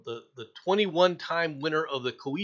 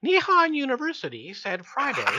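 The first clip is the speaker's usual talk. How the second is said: reading the news much higher, on request; high-pitched voice; 8 semitones higher than usual. Shown as a demonstration. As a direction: pitch the whole read up high as asked. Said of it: "say it in a high-pitched voice".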